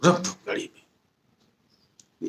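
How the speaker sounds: slow, hushed whisper of a plotter; secret whisper. Retold or imitated. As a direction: whisper the order so no one hears. (धीमी गुप्त फुसफुसाहट)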